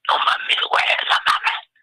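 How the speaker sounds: voiceless, choked whisper from crying; voice gone. Retold or imitated. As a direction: push the words out with no voice left after sobbing. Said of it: "se me fue la voz".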